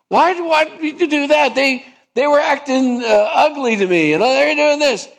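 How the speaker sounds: high, whiny complaining; high whiny self-justifying sinner; indignant protest. Acted. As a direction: protest like a sulky child who's been wronged. Sinner protests God's command.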